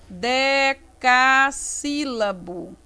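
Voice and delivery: DECASSÍLABO stretched out in syllables; drawn-out, measured teaching voice; slow, syllable by syllable. Shown as a demonstration. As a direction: say the term slowly as if writing it. Teacher introduces term syllable by syllable.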